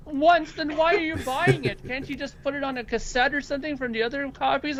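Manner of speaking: high, incredulous mock outburst; imitated upset girlfriend. Girlfriend challenges record buying, high incredulous imitation. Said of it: "she really got upset".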